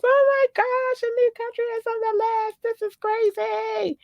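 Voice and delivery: very high, sustained squeal; hammed-up falsetto amazement, drawing out the end; excited inner voice. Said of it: "that's exactly how it goes".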